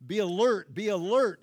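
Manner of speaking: loud, repeated cry to wake them; urgent cry. Acted. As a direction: shout it as a warning. Urgent call in prayer.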